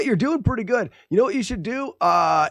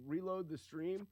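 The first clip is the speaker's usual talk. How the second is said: muttered to self; 22 dB quieter than usual. Real, not acted. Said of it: "Shut up, me".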